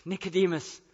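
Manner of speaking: ordinary talk, nothing marked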